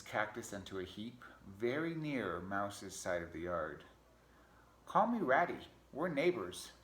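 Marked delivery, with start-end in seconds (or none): 4.9-6.7 high, loud critter greeting; friendly pack rat. Acted. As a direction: introduce yourself as a chipper storybook rat. Rat introduces himself in story.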